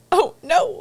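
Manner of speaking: high-pitched mock dismay. Grandma seeing him being sweet, high mock dismay. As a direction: squeak out a dismayed oh no. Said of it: "she's like".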